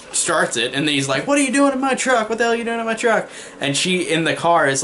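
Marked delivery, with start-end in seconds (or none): 1.3-3.3 mock angry yell, much higher and fast; hammed-up trucker snapping at an intruder; angry truck driver.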